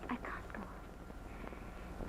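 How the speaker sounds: slow, barely voiced whisper of despair; whispered in real distress; breaking down. Real, not acted. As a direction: she can hardly get the words out. Breaking down while testifying.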